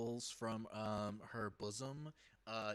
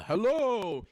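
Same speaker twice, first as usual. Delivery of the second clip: bright loud high hello; loud shopkeeper greeting; 9 semitones higher, 12 dB louder than usual. Acted. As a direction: call out a big shop greeting. Shopkeeper twin greets loudly.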